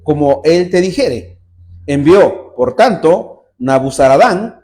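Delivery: loud, slow solemn Bible reading; scripture reading. Acted. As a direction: proclaim the verses to the church. Reading scripture aloud to congregation.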